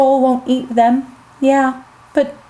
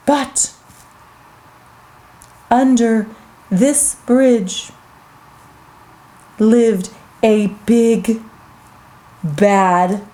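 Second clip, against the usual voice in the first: low, slow, suspenseful narration; ominous storytelling; 4 semitones lower, much slower than usual. Acted. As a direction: build suspense with long pauses.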